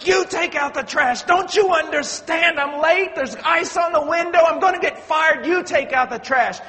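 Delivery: frantic husband yelling he's late; angry yell. Retold or imitated. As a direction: snap back at her without thinking. Husband yells at wife again.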